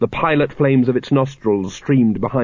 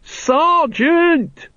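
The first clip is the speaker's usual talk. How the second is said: very high long-drawn shout. A narrator's reading. Carrot warning Colon as he aims, shouting. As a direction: shout a panicked warning, pitch right up. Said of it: "when you keep shouting".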